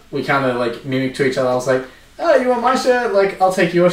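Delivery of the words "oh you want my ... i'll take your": higher-pitched, eager quoted offer; eager retold offer. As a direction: act out calling to a fellow fan, pitch jumping up. Re-enacting his shirt swap offer.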